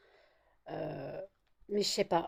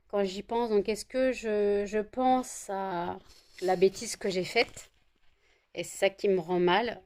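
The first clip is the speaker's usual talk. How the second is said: usual speaking voice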